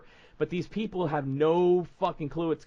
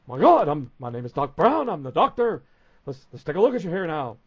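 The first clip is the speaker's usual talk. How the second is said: loud, hammed-up doctor, much louder; booming comic doctor introducing himself; 2 semitones higher, 8 dB louder than usual. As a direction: announce yourself like a grand TV doctor. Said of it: "I'm doing Doctor R P".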